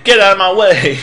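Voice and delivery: high, loud command; pushy order. Acted. Game character ordering the player out of the way, pushy. Corrects the garbled repeated order.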